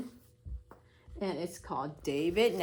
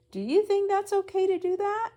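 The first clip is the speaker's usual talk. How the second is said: much higher, louder, a big sing-song question; exaggerated question; 13 semitones higher, 5 dB louder than usual. Real, not acted. Reading teacher asking the class if grabbing hair is okay, high and loud.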